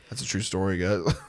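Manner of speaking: ordinary talk, nothing marked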